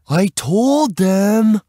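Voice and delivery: put-on high, loud, drawn-out kid whine; put-upon kid, loud and high; exasperated boy. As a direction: complain slowly in a boy's high voice. Exasperated boy speaks to police.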